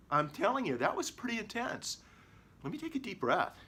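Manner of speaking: high, breathless rattled talk; shaken after a quake. Shown as a demonstration. Telling stream viewers the quake felt intense, high and shaken. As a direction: still rattled and catching breath.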